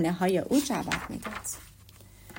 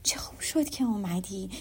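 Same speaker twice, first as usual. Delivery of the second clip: hushed whisper; 2 semitones lower, 6 dB quieter than usual. A narrator's reading. Lydia greets Charlotte in the hallway, whispering. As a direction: whisper it like fresh gossip. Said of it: "با صدای آهسته گفت".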